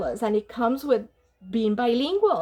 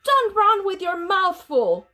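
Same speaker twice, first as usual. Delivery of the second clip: loud, higher scolding call; scolding mother; 6 semitones higher, 4 dB louder than usual. A narrator's reading. Mother scolding her kitty boy, loud. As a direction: scold him as he runs past. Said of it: "hollered Mama".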